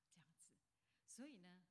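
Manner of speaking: usual speaking voice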